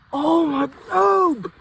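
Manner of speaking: loud overjoyed cry; excited shout. Real, not acted. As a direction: shout his name in disbelief. Overjoyed at the bird.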